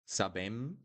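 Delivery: sabem rising onto a humming M; wrong closed M. Shown as a demonstration. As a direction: end sabem with the lips pressed shut.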